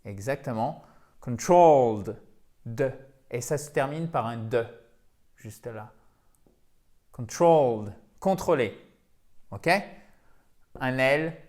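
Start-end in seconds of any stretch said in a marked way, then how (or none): 1.2-2.2 controlled with a long, loud TROLLED; stress on second syllable. Shown as a demonstration. Teacher showing English learners where the accent falls, stressed second syllable. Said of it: "accent tonique ici". 7.1-7.9 controlled with a drawn-out, falling TROLLED; stress on second syllable. Shown as a demonstration. Teacher repeating the English word for learners, stressed second syllable. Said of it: "accent tonique ici".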